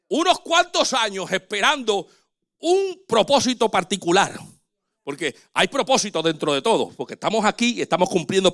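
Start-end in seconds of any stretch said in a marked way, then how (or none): none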